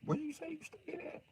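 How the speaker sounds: very high, soft sly question. Acted. Sniper quietly asking the accuser where he lives. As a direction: fish for his address quietly.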